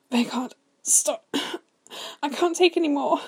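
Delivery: high, loud, strained begging; sobbed plea. A narrator's reading. Man pleading with his brother to stop, sobbing. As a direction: beg in a high, tearful voice. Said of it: "Bord sobbed".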